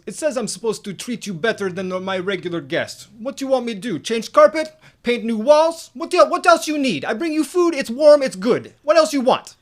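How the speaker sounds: put-on loud Russian-accented exasperated complaint; Vlad. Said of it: "sounds like all of my Russian friends".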